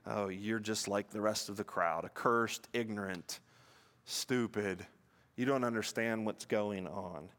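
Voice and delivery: low, quiet exasperation as mimicked Pharisees; frustrated. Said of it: "the Pharisees get frustrated".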